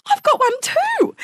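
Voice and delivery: high, loud imitation of a thrilled child; excited son. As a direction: ask it like an overexcited little boy. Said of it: "He was so excited".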